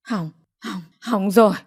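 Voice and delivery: weak, breathless start, then firmer; mother winded with dismay; gasping for breath. A narrator's reading. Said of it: "Bà mau vuốt ngực thở dốc". (thở dốc, hụt hơi)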